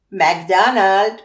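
slow, loud yapped American twang; nasal American accent. A narrator's reading. Father leans over his newborn son, yapping in nasal American accent. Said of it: "prend son plus bel accent nasillard et jappe".

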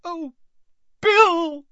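very high wail bursting out loud; anguished cry. A narrator's reading. Mother seeing her son's mangled face, crying out in anguish. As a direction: start small, then cry out the name.

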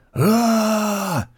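high, strained war cry; battle cry. A narrator's reading. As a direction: shout it like a charging soldier.

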